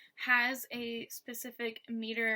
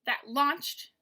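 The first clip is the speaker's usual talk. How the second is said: that light, launched higher and louder; stress on launched; 4 semitones higher, 5 dB louder than usual. Shown as a demonstration. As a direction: throw the weight onto launched. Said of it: "that is unstressed".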